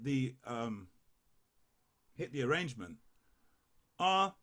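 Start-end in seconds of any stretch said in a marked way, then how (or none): none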